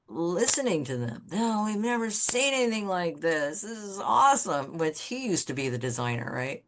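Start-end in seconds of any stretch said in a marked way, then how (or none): 1.3-4.8 high-pitched imitation of impressed editors; gushing praise. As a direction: gush like an admiring client, retold. Editors gushing over designs at lunch.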